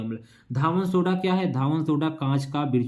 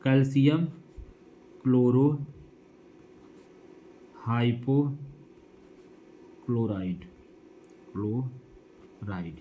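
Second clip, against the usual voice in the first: slow dictation; 3 semitones lower, much slower than usual. Shown as a demonstration. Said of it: "sir वो भी बता दीजिए".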